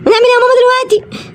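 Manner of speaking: put-on high, small child voice, frightened; a tiny cub whimpering to mom; little bear cub asks mom, high and scared. Cub asks mother during attack.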